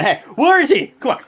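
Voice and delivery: high, loud acted demand; freaking out. Seth Rollins hunts backstage, imitated as freaking out. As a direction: imitate a wrestler yelling backstage in a frenzy. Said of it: "and he's freaking out".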